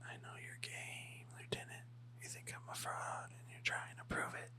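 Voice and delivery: menacing whisper; mock dramatic whisper in his dubbing; whispered dub. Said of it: "I'm gonna do the A D R".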